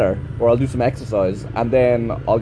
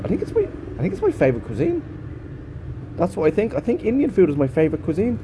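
higher, put-on voice, lightly hammed; mock posh voice; 7 semitones higher, 4 dB quieter, faster than usual. Vlogger mocking his own food opinion in a put-on voice. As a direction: say it in a silly put-on fancy voice.

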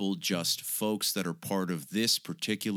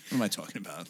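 ordinary delivery

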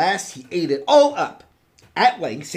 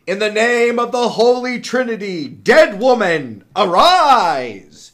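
loud, drawn-out theatrical invocation; grand incantation; 5 semitones higher, 8 dB louder than usual. Acted. Saint Peter raising the dead princess, grand incantation. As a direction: proclaim it slowly building to a big finish.

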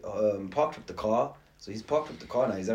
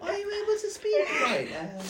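put-on high girl's voice, cracking with laughter; fake girl's voice; 4 dB louder than usual. Prank caller, high girl voice, laughing. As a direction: stay in the high girly voice while fighting back laughs. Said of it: "make it a girl's voice".

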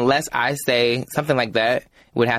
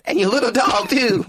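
high witch voice, rising; Wicked Witch; 10 semitones higher than usual. Acted. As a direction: cackle it like the Wicked Witch of the West. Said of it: "I just sound like a witch".

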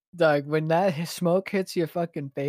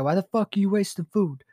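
higher mock angry dad yell; mimicking angry dad; 6 semitones higher, 3 dB louder, faster than usual. Son imitating his mad dad at the sandwich, mock angry. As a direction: toss off dad's scolding, a little higher. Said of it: "He got so mad".

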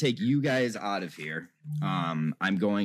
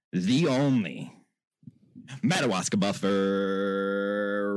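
drawn-out mock ring introduction; announcer intro; much slower than usual. Host brings in the one and only buffer, announcer style. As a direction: stretch each word like a fight announcer.

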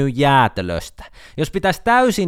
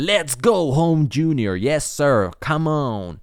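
mock hyped cheer of local fans; imitating Palokka fans cheering the band; 4 semitones higher, much slower than usual. Local listeners hyping their hometown band, mock cheer. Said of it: "kuuntelijat on silleen".

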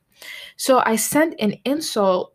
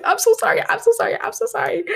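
much higher, pleading, rushed repeats; frantic apology; 13 semitones higher, faster than usual. Retold or imitated. To the girl she insulted, a high pleading apology. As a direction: squeak out a desperate sorry.

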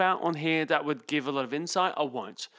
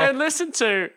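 broad bush Australian, hammed-up; mock Aussie country accent; 9 semitones higher, 3 dB louder than usual. Host reading listener's insulting email in mock outback Australian accent. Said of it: "out in the country accent".

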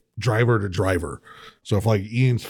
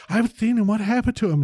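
put-on high girly gushing voice; hammed-up gushing girl; imitating the girl; 12 semitones higher than usual. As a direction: mimic her excited chatter, pitched up. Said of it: "she's like".

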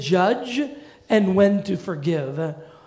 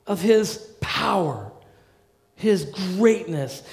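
normal talking voice

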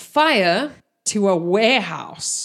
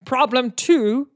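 orc broken speech. Acted. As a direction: count them off like a blunt brute. Orc listing problems in broken speech.

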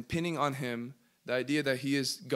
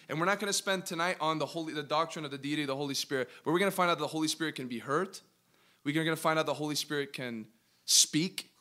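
ordinary talk

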